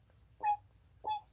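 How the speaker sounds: high, soft little squeaks; animal squeak. Shown as a demonstration. Confirming how they go, soft high squeaking. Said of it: "they go squeak".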